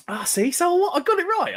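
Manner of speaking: high, excited gloat; triumphant cry. Real, not acted. Gloating to chat that he guessed right, high and excited. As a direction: crow that the guess was right. Guessed college year correctly.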